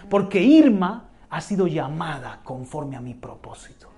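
ordinary talk

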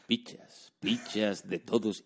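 slow, breathy mock ad voice, imitating; deep announcer voice. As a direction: draw the words out like a deep-voiced ad narrator. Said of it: "ese hombre diciendo".

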